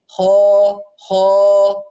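خا with a full, heavy emphatic kh; teacher modelling the proper خا; correct heavy kha. Shown as a demonstration. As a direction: say خا thick and open, lips unrounded. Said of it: "هكذا يكون النطق الصحيح".

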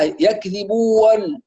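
يكذبون ending bu-wan, with an a; opened waw. Retold or imitated. Teacher mimics a mistake, opened waw. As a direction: add a wa before the final n of يكذبون. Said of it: "وقد يفتحها".

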